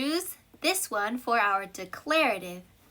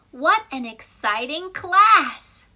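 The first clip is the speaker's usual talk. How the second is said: louder, slow, thrilled delivery; excited exclamation; 3 semitones higher, 8 dB louder, slower than usual. Shown as a demonstration. Teacher contrasting a plain sentence with an excited exclamation. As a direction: sound genuinely thrilled about the class. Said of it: "또는".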